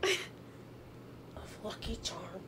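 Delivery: slow breathy whisper of the punchline; hushed reveal. Real, not acted. Telling a friend what he ate, slow whisper. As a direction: whisper it slowly like a scandal.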